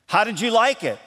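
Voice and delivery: high, eager question; mildly voiced cook asking. Cook asking the family about a new dish, eager and high. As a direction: ask the taster for a verdict. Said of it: "same words, just different inflection".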